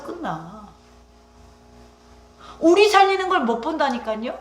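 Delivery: preacher rousing the congregation, loud and fervent; full-force preaching. Acted. Pastor preaching to the congregation, in loud fervent preaching.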